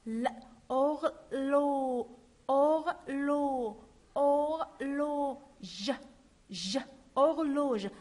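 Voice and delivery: "horloge" built up slowly, or-lo then -ge; slow syllable build-up. Shown as a demonstration. Teacher to pupils, building the word slowly. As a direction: spell out "horloge" piece by piece. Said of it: "Alors, on dit".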